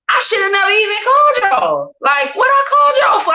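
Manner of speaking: high, tearful yell at the police; crying shout. Retold or imitated. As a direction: shout it through tears. Retelling outburst at police.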